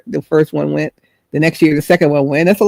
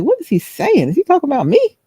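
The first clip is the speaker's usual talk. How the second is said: puzzled inner voice; 10 semitones higher than usual. Retold or imitated. Inner worried thoughts.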